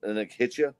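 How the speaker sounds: ordinary delivery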